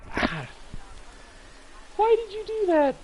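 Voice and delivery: high, loud pained outburst; sudden squeal of surprise at the cat; startled yelp. Real, not acted. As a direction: yelp as the claw goes in. Cat clawed his chest.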